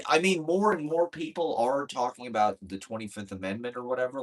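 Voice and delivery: ordinary talk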